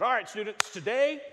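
loud, high classroom announcement; imitated teacher addressing students; Greek teacher. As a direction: call the class to attention brightly. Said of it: "my Greek teacher said".

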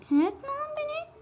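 high panicked yelp at the mob; panicked shriek. Real, not acted. Shrieking at the mob as it attacks him, panicked. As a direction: squeal it out in fright.

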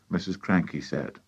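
plain reading voice

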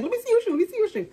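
excited woman. Retold or imitated. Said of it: "She was like".

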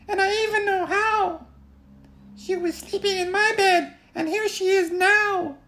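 a proud tiny cub detective; Baby Bear. Acted. Baby Bear announcing his discovery, tiny high proud voice.

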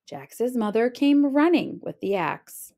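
ordinary delivery

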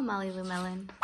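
ordinary delivery, nothing marked

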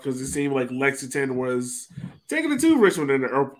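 ordinary delivery

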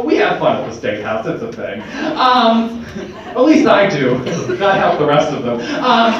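ordinary talk

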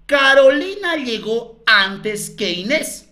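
slow, spaced-out reading of the clue; slow dictation. Shown as a demonstration. Teacher dictating the third clue to colleagues, slowly. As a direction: lay each word down slowly for copying.

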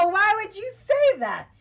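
high, loud, slow disbelieving yell; outraged outcry. Real, not acted. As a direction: yell it, appalled at the choice. Outraged at a game option.

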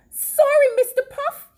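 high, loud apologetic call. A narrator's reading. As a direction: call up high to someone above. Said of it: "he called".

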